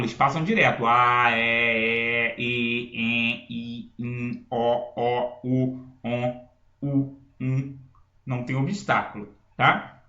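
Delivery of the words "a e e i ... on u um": slow sung-out run of vowels, each held and lower; vowel series. Shown as a demonstration. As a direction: chant the vowels one by one. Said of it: "sons que não têm obstáculo". (vogais cantadas uma a uma)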